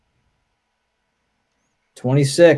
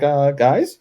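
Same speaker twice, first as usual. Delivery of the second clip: mock British, lightly voiced, unsure; lightly done British accent, hesitant question; timid British voice. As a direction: imitate a lost British paratrooper asking. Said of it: "British are like".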